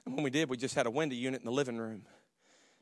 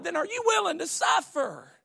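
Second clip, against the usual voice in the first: shouted sermon line; 4 dB louder than usual. Acted. Preaching at full force.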